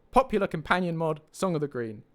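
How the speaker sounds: usual speaking voice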